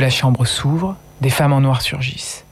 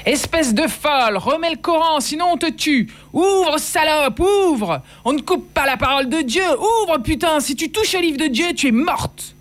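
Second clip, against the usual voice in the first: much higher raging yelled threats; men yell threats through the locked door, much higher; 13 semitones higher than usual. Acted. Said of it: "J'ai entendu les hommes hurler".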